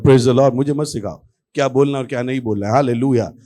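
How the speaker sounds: ordinary talk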